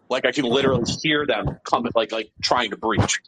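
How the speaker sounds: ordinary talk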